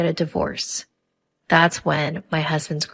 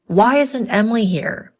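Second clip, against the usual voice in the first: raised, higher voice calling out; yelling father-in-law; 5 semitones higher, 5 dB louder than usual. A narrator's reading. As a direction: demand it loudly across the room. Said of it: "I heard yelling from the venue".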